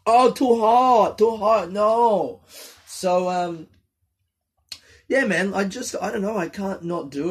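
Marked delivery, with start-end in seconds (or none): 0.1-2.4 too hot. Real, not acted. Burns mouth on tea.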